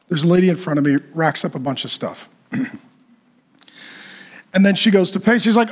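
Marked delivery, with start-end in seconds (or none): none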